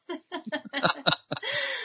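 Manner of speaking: a long, high fit of quiet laughter; high breathy giggle. Real, not acted. After telling his allergy trick, a high soft laugh.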